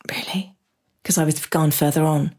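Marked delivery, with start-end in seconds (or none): 0.1-0.5 very soft, private questioning; inner doubt. Retold or imitated. Inwardly questioning the healer's verdict, hushed doubt. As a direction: think it aloud under your breath. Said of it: "inside I went".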